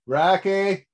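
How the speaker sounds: high-pitched call to a pet; calling out for a pet to come. Real, not acted. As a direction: call the pet over, voice lifted.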